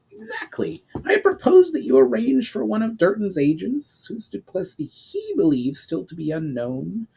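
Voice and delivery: high, eager goblin voice; excited goblin. Acted. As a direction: sell the scheme with triumphant glee. Urgar smiles triumphantly, proposes plan.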